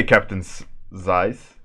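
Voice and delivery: ordinary delivery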